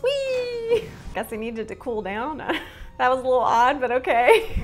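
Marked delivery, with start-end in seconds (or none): 0.0-0.8 playful whee. Pretend. Character jumps into water.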